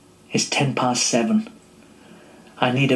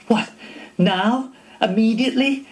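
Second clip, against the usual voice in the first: panicked disbelief; 8 semitones higher than usual. Retold or imitated.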